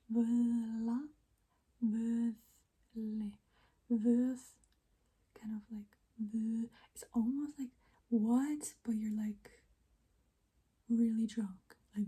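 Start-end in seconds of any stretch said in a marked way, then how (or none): 3.9-12.1 soft whispered ASMR. Real, not acted. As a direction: whisper close to the mic.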